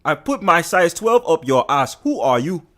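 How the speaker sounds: indignant mock Nigerian accent. Streamer poses as an insulted Nigerian, mock-angry Nigerian accent. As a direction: scold in a put-on Nigerian accent. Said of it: "I am really Nigerian".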